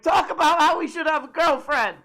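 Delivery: high, loud mock heckle; fan yelling. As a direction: shout a request from the crowd. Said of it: "do they yell that at Jordan Peterson".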